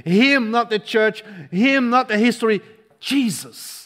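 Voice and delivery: ordinary delivery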